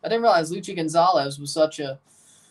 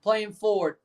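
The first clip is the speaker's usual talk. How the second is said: ordinary talk, nothing marked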